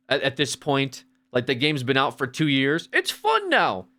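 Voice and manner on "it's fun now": much higher mock singsong on fun; mocking fan voice. Mocking the claim that the game is fun now, high and silly. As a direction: echo the fans' line in a silly high pitch.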